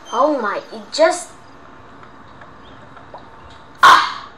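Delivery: ordinary talk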